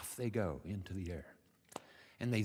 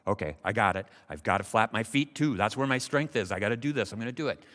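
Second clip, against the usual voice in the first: louder, emphatic self pep talk; determined frog; 7 dB louder, faster than usual. Acted. Frog talks himself into trying again, determined. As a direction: psych yourself up with force.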